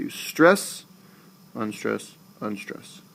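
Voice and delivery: strong-weak-weak beat on stress, unstress, unstress; stress then unstressed. Shown as a demonstration. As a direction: punch stress, drop away for unstress, unstress. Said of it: "It starts high and it goes down".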